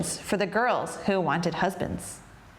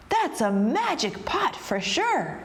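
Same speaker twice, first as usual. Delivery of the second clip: higher, slow and marvelling; amazed; 5 semitones higher than usual. A narrator's reading. Big Anthony has seen the pot cook, amazed. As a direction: swoop up on the key words in wonder.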